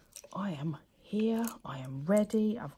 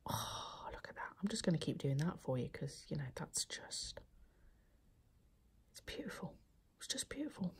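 ASMR whisper; 3 semitones lower, 13 dB quieter than usual. Real, not acted. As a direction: hush it like an ASMR video.

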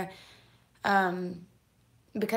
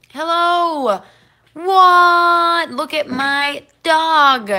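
excited shriek; 8 semitones higher, 16 dB louder, much slower than usual. Real, not acted. Dog walks into the stream.

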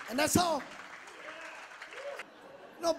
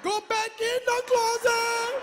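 higher, louder, slow mock plea; 8 semitones higher, 5 dB louder than usual. Father jokingly telling son to go back in the closet. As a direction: beg him back in, hammed up. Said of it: "was like".

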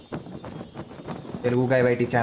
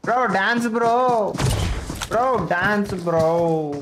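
excited taunting; 8 semitones higher, 3 dB louder, much slower than usual. Real, not acted. Taunting defeated enemy to dance.

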